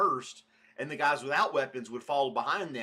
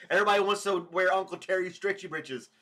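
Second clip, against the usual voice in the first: laughing through the words, louder; 6 semitones higher, 5 dB louder than usual. Real, not acted. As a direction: say it while cracking up. Laughing at chat comment.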